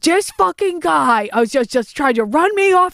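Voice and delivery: high-pitched furious rant, slowed and spat out. Retold or imitated. Retelling road rage on the phone to her cousin, high furious rant. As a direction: shriek it like a furious cousin losing it. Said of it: "She does turn into Bobby Boucher".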